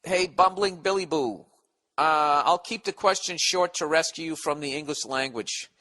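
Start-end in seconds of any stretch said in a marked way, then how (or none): none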